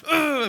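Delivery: frustrated parent's grunt; aggressive grunt. Retold or imitated. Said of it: "a bit aggressive".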